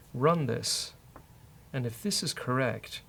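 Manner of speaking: normal talking voice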